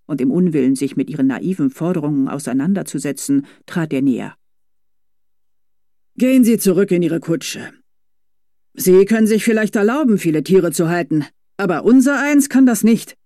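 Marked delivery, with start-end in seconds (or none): 6.2-13.1 raised, resentful rebuke. A narrator's reading. Driver telling the rich girl to go back, resentful raise. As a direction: barely hold back his grudge. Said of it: "den Groll in seiner Stimme".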